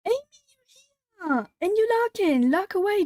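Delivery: high-pitched, gushing welcome; real thrill at spotting a friend; delighted greeting. Real, not acted. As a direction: greet a surprise guest in a high voice. Spots a friend arriving in chat.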